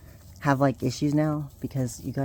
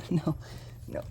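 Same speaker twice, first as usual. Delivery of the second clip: normal talking voice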